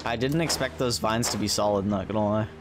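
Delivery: ordinary talk, nothing marked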